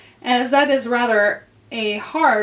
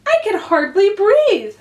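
high, louder protest from a small character; complaining Dormouse; 10 semitones higher, 5 dB louder than usual. A narrator's reading. Dormouse crushed next to growing Alice, high protest.

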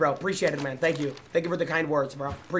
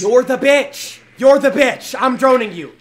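louder, higher heated yell; angry shout; 7 semitones higher, 11 dB louder, slower than usual. Real, not acted. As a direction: yell back in a heated argument. Shouting back at teammate.

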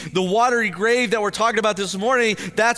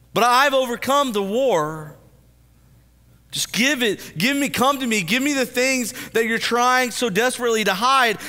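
fervent preaching; 4 semitones higher than usual. Acted. Voicing Jesus, preaching fervently.

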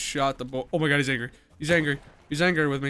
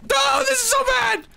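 high panicked shout, much higher and louder; 6 dB louder than usual. Real, not acted. Eagle attack in game.

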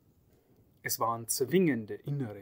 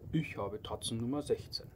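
plain reading voice

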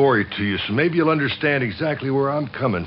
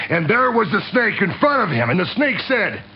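ordinary delivery

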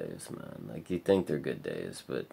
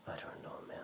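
barely voiced, much softer whisper; real whisper; 15 dB quieter than usual. Real, not acted. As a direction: drift into a defeated whisper. After a long pause.

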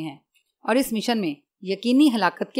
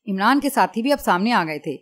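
ordinary delivery, nothing marked

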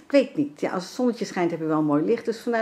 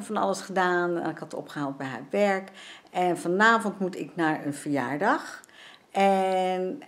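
normal talking voice

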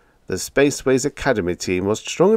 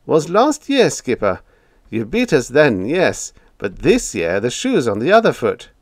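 teasing, grinning; taunting; 5 semitones higher, 4 dB louder than usual. A narrator's reading. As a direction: rub it in playfully. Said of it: "taunted him, grinning".

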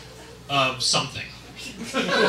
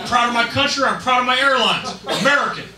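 loud, high patriotic rant; belligerent, hammed-up patriot yelling at the driver; true US patriot; 6 semitones higher, 5 dB louder than usual. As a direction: shout it with outraged national pride. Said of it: "U S patriot".

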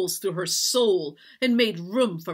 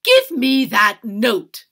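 loud, slow, forceful order; raised, imperious command; angry demand; 6 semitones higher, 14 dB louder, much slower than usual. A narrator's reading. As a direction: demand it word by word. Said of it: "Madam demanded".

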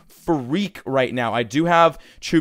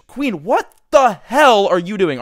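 loud, high, slow yell of disbelief; a gamer berating his hero; exasperated yell; 6 semitones higher, 6 dB louder, slower than usual. Real, not acted. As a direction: yell at the useless queen. Yelling at his game queen.

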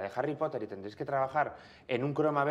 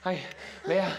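out of breath; 4 semitones higher, slower than usual. Shown as a demonstration. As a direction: gasp as if after a marathon. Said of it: "has corrido una maratón".

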